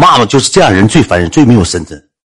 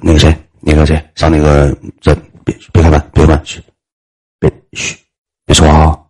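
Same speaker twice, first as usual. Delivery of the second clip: scared hush; 8 semitones lower than usual. Retold or imitated. Scared fat guy hushing narrator.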